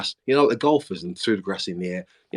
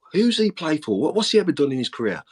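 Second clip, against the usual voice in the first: higher, challenging questions; mock scepticism; 7 semitones higher than usual. New manager imitates doubting players, mock sceptical. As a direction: imitate doubters quizzing a newcomer.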